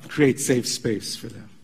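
usual speaking voice

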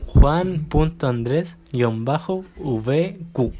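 slow, each part said separately; spelling it out. Shown as a demonstration. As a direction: spell the handle out slowly. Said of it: "Ya, de nuevo".

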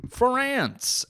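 mock Olympics commentator welcoming viewers back; announcer. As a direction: announce it like a TV sports host. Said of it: "the moderators on the".

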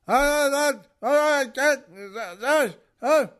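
high mock-angry gibberish; imitated loud anger. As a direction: mimic a man snapping back in nonsense. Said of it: "but loud again. He's getting angry".

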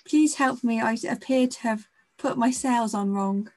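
high theatrical plea, hammed up; dramatic damsel. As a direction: play the helpless damsel at sea. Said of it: "really dramatic pose of".

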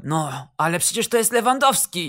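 a coach's understated shout of disbelief; mild exclamation. A narrator's reading. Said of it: "krzyknął Smuda". (lekko podniesiony głos)

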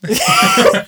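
squealing laugh. Real, not acted.

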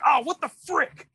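high pitched angry parent holding back a curse; mock restrained anger. Parent mad in front of kids, high mock anger. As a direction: blurt it like a furious parent censoring himself. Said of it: "really mad but they don't wanna curse".

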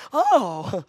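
forced breezy reassurance with a laugh; mock nonchalance hiding panic; fake calm. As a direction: pretend it's nothing, laughing it off. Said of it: "you never act like it's bad".

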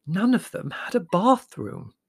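high, slow, incredulous Mother; astonished. A narrator's reading. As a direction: spell it out slowly in astonishment. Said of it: "Mother regarded him with astonishment".

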